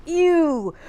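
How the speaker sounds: a loud high "Ew!"; daughter's playful disgust; mock disgust. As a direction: play the teenager grossed out. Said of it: "she says".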